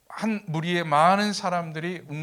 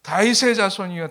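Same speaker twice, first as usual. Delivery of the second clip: raised, louder preacher's imitation of a shout; loud cry out; 4 semitones higher, 10 dB louder than usual. Blind beggar calling out to Jesus, loud imitated cry. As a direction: call out loudly for help. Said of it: "큰 소리로 외칩니다".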